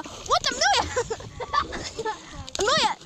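high, drawn-out disbelief; stunned and amused; surprised. Real, not acted. Daughter's surprising catch.

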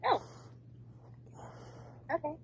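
ordinary talk

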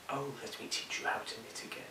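fast breathless whisper of the line. Acted. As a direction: rush it under your breath. Rehearsing line under breath.

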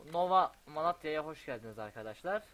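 loud, high, announcer-like greeting; excited welcome. Real, not acted. Streamer greeting viewers to his new city, loud and bright. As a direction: throw open the doors for the audience.

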